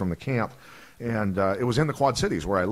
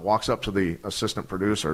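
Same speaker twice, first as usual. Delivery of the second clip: usual speaking voice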